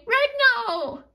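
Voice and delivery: put-on happy toddler cry. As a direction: call out to her big brother with joy.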